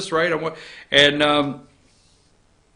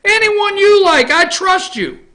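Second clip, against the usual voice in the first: mock gushing; 4 dB louder than usual. Ironic slip aside.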